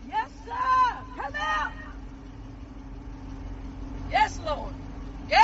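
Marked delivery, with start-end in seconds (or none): none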